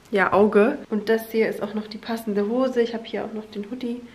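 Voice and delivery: ordinary talk, nothing marked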